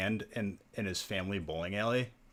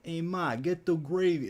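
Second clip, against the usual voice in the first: mock Italian-American; 9 semitones higher than usual. Mocking fake Italian family.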